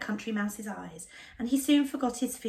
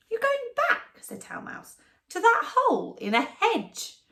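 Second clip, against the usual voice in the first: high, incredulous mouse; mock his choice; scoffing mouse; 11 semitones higher, 4 dB louder than usual.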